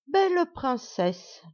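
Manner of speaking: slow, high, oily flattery; flattering dwarf. Acted. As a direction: kneel and drawl out the compliment. (nain flatteur et mielleux)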